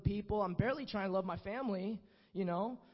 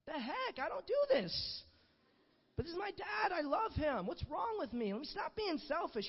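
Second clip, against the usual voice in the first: crying out; 7 semitones higher than usual. Retold or imitated. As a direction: push it out as tears come. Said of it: "I couldn't help but cry".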